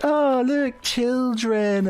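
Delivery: high-pitched mock wonder; high, dreamy put-on delight; distracted cooing. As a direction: coo it airily, imitating someone easily charmed. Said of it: "you've been all sort of like".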